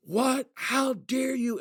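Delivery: high, shouted fury at a protester; high-pitched enraged yell; retold furious yell. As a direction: yell it like a Marine losing it. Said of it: "screaming his head off, totally insane".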